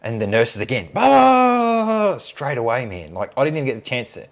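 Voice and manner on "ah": long, loud, high-pitched "aah"; hammed-up fangirl sigh, drawn out high; mock swooning cry. Nurses fawn over the surgeon again, mock swooning cry.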